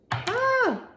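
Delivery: sudden high yelp of surprise; a sharp surprised cry out; startled yelp. Real, not acted. Reader startled mid spread, high yelp.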